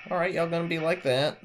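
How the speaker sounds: high, fast mock taunt. Real, not acted. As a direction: taunt the enemies. Taunting enemies.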